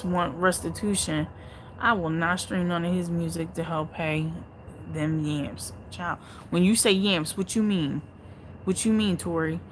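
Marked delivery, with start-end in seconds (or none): none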